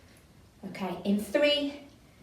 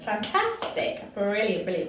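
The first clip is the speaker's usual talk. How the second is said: normal talking voice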